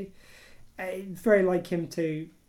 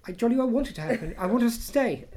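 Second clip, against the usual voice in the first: raised, louder apologetic Brit protest; overly polite Brit; 5 semitones higher, 5 dB louder than usual. Retold or imitated. Mock British politeness to the rest of the world. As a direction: dither politely but keep pushing. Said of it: "we're just gonna be so British about it".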